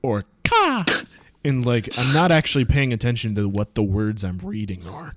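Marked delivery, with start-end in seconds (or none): 0.4-0.8 high loud ka; crow caw. Shown as a demonstration. Reader explains the random bird noises, high caw. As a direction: drop in a quick crow ka. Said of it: "I just choose ... to say".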